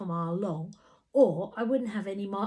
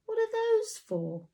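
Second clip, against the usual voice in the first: puzzled Tina; 13 semitones higher than usual. A narrator's reading.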